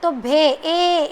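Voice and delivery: भे and ए drawn out long with mouth open wide; slow vowel demo. Shown as a demonstration. Teacher shows pupils how the ए sound comes out, slowly. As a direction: stretch the ए sound so pupils hear it. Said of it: "ए का sound किस तरह से निकल के है".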